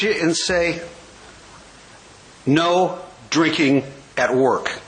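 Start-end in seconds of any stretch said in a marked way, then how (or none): 2.4-4.8 slow, low mock reprimand, word by word; stern boss. Boss looks at drinking employee, slow mock-stern warning. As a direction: imitate a boss spelling out a rule.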